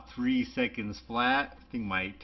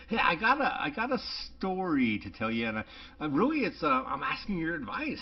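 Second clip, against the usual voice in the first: imitating Noah; 6 semitones higher than usual. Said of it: "He's like".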